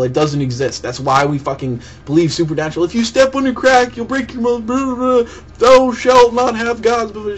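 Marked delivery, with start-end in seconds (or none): 2.8-7.4 high, sing-song parody of sayings; mocking sing-song. Mocking superstitions and commandments in a high sing-song. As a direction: parrot the old superstitions mockingly.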